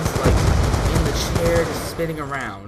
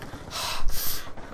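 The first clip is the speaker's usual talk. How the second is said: heavy huffed breath, imitating anger; angry heavy breathing. Teacher fuming at the accused students, mock angry huff. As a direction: huff like someone who is mad. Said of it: "doing that heavy breathing thing".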